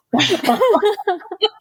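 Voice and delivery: high bursting laugh. Real, not acted. Host laughing at the dramatic barrel scene, amused.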